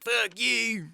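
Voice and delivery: high-pitched cartoon curse; mock yelling. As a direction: holler it as the stone creature. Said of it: "he's yelling at this guy".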